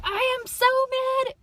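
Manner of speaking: high, loud mock outburst of anger; hammed-up yell of "I am so mad"; mock angry venting. Shown as a demonstration. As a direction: vent it at the top of your voice, hammed up.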